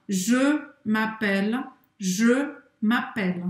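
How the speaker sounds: "je" said with O-shaped lips while saying E, slow; rounded "je". Shown as a demonstration. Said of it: "faz a boca do O e fala E". ("je" com boca de O falando E, devagar)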